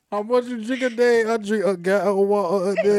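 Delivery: put-on playful European accent, consonants swallowed; mock European accent. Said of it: "A European accent".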